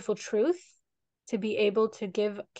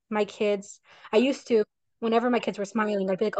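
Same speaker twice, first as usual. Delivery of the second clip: ordinary delivery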